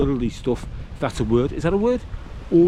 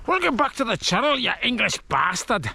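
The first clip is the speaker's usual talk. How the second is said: mock-aggressive Glaswegian, higher in pitch; aggressive Glasgow accent; 5 semitones higher than usual. Vlogger welcoming viewers in an aggressive Glasgow accent. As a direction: snarl the welcome like a jokey Glasgow hardman. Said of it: "Ramsay Nesbitt in Glasgow or something".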